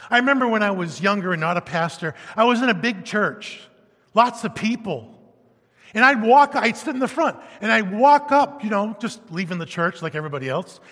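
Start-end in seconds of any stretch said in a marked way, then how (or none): none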